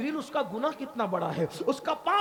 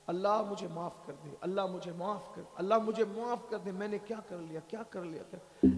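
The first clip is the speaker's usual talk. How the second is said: quiet, lower tearful begging; weeping plea; 4 semitones lower, 7 dB quieter than usual. Acted. Sinner alone to God, soft tearful plea. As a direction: murmur for forgiveness as if crying. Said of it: "رو رہا ہے".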